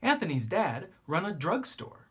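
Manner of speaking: ordinary delivery, nothing marked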